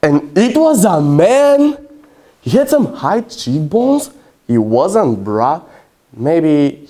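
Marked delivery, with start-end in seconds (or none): 0.0-4.1 high, slow, stunned reveal; slow-motion comic disbelief; acted astonishment. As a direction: draw out the shocking discovery in a high voice. Shock reveal of mistaken sex.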